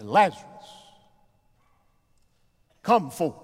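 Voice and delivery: calling out the name, then a loud command. Acted. Preacher voicing Jesus calling Lazarus from the grave, loud commanding call. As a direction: call him out of the tomb at full voice.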